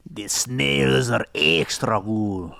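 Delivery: slow put-on waiter accent that drifts Italian; a fake French server touting the snails; lightly faked accent, slow; mock French waiter. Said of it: "That sounds a little Italian".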